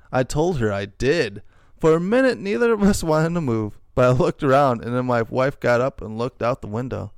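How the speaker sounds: ordinary talk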